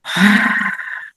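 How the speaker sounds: airy, voiceless sigh; deep breath out. Shown as a demonstration. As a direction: release the breath audibly, modelling it for the listener. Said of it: "take another deep breath".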